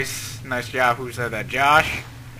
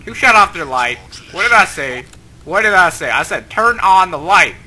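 mock scolding; 7 dB louder than usual. Scolds whoever turned off the light.

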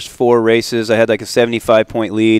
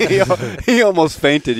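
laughing; 7 semitones higher, slower than usual. Real, not acted. Laughing while telling story.

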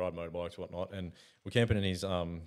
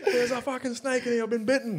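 panicked mate; 6 dB louder than usual. Retold or imitated. Mate panicking, laughing while retelling.

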